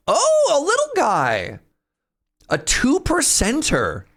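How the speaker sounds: high, slow, cooing Oh, a little guy; delighted high squeal. Real, not acted. Streamer sees a little guy on the wheel, high cooing delight. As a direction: coo over it like a cute find. Wheel lands on rare prize.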